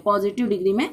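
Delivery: ordinary talk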